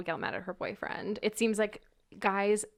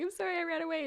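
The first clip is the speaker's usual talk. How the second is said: mock crying apology; 9 semitones higher, 3 dB quieter, faster than usual. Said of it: "came home crying".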